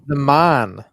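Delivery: loud "man" as German-sounding "mahn"; German-sounding accent. Acted. Co-host boasts in his introduction, loud German-sounding accent. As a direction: declare it loudly with a German-like open vowel. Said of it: "why are you doing a German accent".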